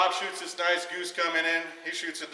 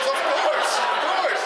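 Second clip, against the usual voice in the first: high squeaky laughing repeat; laughing high mock voice; 5 dB louder, slower than usual. Buddy Bob agreeing to the hunt, laughing in a high voice. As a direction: giggle it out, pitched way up, louder.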